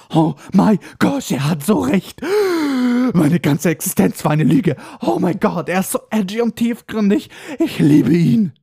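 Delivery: hammed-up gushing fangirl; Hermine in ecstasy. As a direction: swoon and gasp with mock rapture. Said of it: "Hermine hat sich bei diesem Satz bepisst vor Ekstase".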